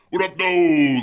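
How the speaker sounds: high, loud, carefree greeting; dad's phone greeting. Retold or imitated. Dad greeting his child on a call, loud and carefree. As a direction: call it out big and breezy, imitating dad. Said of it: "I call him and he's like".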